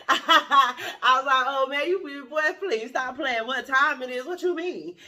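loud laughing disbelief. Real, not acted.

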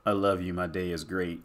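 soft, low read-out of a text; reading a text softly. Retold or imitated. Reading his girlfriend's text to camera, soft read-out. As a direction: murmur the message aloud. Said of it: "my girl texting me now".

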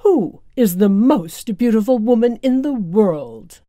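a lightly voiced vain queen; evil queen voice. A narrator's reading. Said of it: "the evil queen asked the magic mirror".

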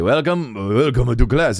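imitated teacher's greeting in a Japanese accent; thick Japanese accent. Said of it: "from Japan, he's thick accent".

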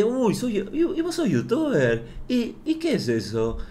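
mock the nosy hairdresser's sugary curiosity; voz de interés fingido. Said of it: "esa vocecita de interés". (imitando al peluquero con voz aguda de interés falso)

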